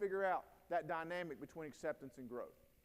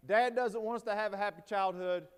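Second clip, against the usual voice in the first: louder, a son calling out to the next room; retold yell; 2 semitones higher, 6 dB louder than usual. Teen son calls to mom in other room, loud mock yell. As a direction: call it out as the indignant teen. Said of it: "My son yells into my wife".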